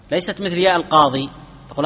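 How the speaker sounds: normal talking voice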